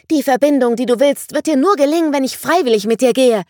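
high, loud defiant snap; a small girl spitting defiance at her captor; hissing girl. A narrator's reading. The girl defying Vangelis who grabs her: high loud angry snap. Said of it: "fauchte das Mädchen".

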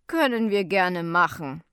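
loud, firm, composed reply; self-assured. A narrator's reading. Officer answers woman's threat, firm and self-assured. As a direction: call the bluff with authority. Officer calmly calls her bluff.

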